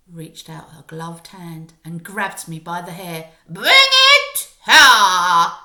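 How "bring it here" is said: very loud, drawn-out witch shout; witch's slow shout. Acted. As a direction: stretch the order out, booming. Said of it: "shouted the Grand High Witch".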